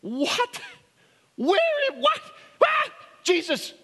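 high, startled sputtering; shocked Peter. Retold or imitated. Peter hearing Jesus will die, shocked and high. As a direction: sputter in disbelief at the news. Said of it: "And Peter goes".

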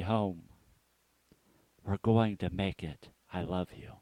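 a father calming his son through the storm; gently soothing, dropping to a murmur at the end; tender comfort. Retold or imitated.

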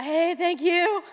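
high, slow, choked-up thanks; a teary, slowed-down thank you squeezed out; tearful thank you. Retold or imitated. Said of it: "So I'm crying".

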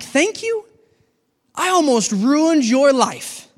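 high, disbelieving question, then slow; as the weakness, baffled at being thanked; a stunned, high-pitched weakness voice; incredulous. Acted.

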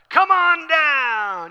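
high, loud, very slow announcer call; hammed-up game-show announcer; Rod Roddy. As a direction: belt it like a game-show host. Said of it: "Rod Roddy on The Price is Right".

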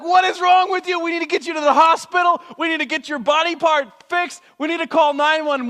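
high, loud, urgent pleading; panicked friend. Retold or imitated. Friend urging the injured rider to the hospital, panicked. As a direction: rush them to the hospital in a panic. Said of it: "and you're like".

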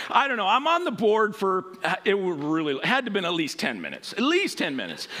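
usual speaking voice

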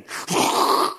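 loud, voiceless whoosh; imitated toilet flush. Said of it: "you hear that".

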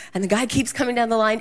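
ordinary delivery

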